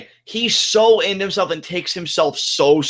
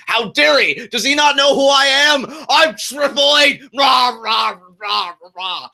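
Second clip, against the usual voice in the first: a hammed-up outraged shout, higher and louder; worked-up Triple H; 8 semitones higher, 4 dB louder than usual. Host mocks Triple H getting worked up, shouting. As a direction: rant like an offended wrestling star. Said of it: "got him so worked up".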